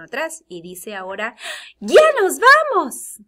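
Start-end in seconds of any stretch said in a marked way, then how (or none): 1.8-3.2 very high, loud excited shout; emotional exclamation. Shown as a demonstration. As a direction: exclaim it with full, drawn-out excitement. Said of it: "convertirla en una exclamación y enseñar emoción". (exclamación emocionada a gritos)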